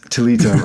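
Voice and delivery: Tilitum said as tuh-LEE-tum, middle stressed; shifted emphasis. Shown as a demonstration. Co-host echoing the host's emphasis, tuh-LEE-tum. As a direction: put the weight on LEE in Tilitum. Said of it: "all depends where you put the emphasis".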